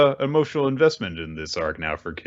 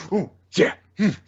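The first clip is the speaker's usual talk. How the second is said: mocking taunt; 11 semitones higher than usual. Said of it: "mocking taunt thing".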